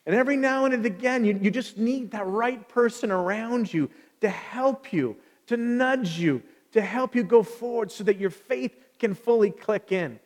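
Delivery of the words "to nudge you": normal talking voice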